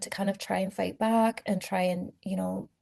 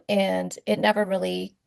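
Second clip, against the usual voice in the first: ordinary talk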